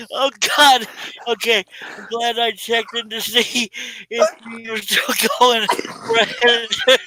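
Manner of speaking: helpless laughing fit, tears streaming, trying to talk through it; crying with laughter. Real, not acted. Host apologizing to viewers while crying with laughter. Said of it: "no way I can stay on the lines laughing like this".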